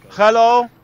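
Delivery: loud bright call; shouted greeting to the crowd; calling out hello. Real, not acted. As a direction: call out to the room.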